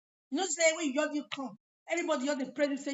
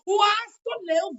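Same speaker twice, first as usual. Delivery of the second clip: louder, drawn-out scolding; loud slow scold; 6 semitones higher, 10 dB louder, slower than usual. Acted. Scolding someone for being late, louder and slower. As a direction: stretch out the scolding words.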